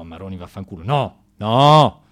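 usual speaking voice

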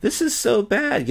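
high, slow mimicking voice; lightly voiced mocking imitation; mock woman's voice. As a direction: echo her in a high mocking tone. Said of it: "You cannot be with people like this anymore".

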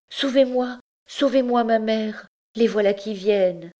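high, pleading panic; anguished plea. Acted. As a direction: beg your mother to save you. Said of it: "se jeta avec angoisse". (supplication angoissée, voix aiguë)

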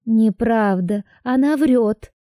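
put-on high, indignant girl; protest, voice lifting high; young woman voice. A narrator's reading. Heroine protests.

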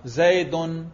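زیدن said as zaydun, ending "-un"; nominative ending. Shown as a demonstration. Teacher quizzes students on the case heard, زیدن pronounced zaydun. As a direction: close زیدن on a clear u-n sound. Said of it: "رفع".

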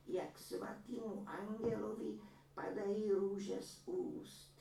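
a granny boasting about her son's painting; proud old woman. A narrator's reading. Old woman voiced in story. (vyšší pomalý hlas pyšné stařenky)

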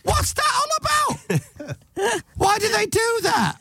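very high comic squawk; exasperated shriek. Acted. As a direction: shriek in baffled mock outrage. Exasperated punchline about the cat.

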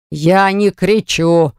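ordinary delivery

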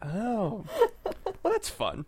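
squeaky mock surprise; a startled high little "oh"; high surprised squeak. Real, not acted.

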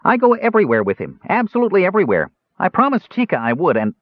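high, bright-pitched kender chatter; cheerful Kender. A narrator's reading. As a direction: play the eager little Kender, voice up high. Kender voiced by reader.

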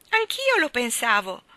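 frank confession. A narrator's reading. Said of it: "fu la sua sbalorditiva affermazione".

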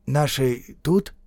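slow, wary question; tentative, drawn-out query; cautious question. A narrator's reading. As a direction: ask carefully through a window. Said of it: "раздаётся осторожный вопрос Конёва".

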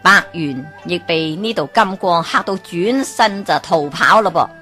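usual speaking voice

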